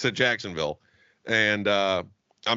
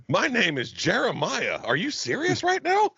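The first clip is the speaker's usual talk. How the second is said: higher, slow mock announcement that jumps way up; 7 semitones higher than usual. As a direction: tease him with a sing-song mock. Mocking friend's full name.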